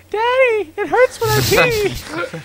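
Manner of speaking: put-on very high, slow, squeaky four-year-old; squeaky little girl voice. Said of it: "Would I talk in a squeaky voice".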